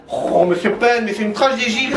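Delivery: ordinary talk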